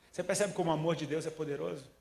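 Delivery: ordinary talk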